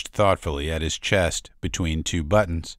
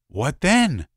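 eager; 9 semitones higher, 4 dB louder than usual. Acted. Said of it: "bug-eyed".

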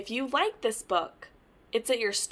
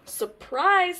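high, eager "Surprise"; spring it on them gleefully; little duck showing off a surprise; excited surprise; 8 semitones higher, 7 dB louder than usual. Acted.

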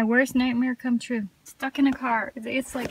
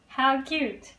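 normal talking voice

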